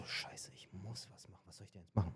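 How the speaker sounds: barely audible whispered thought; whispered curse. Retold or imitated. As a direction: hiss the worry to yourself under your breath. Inner thought, very quiet.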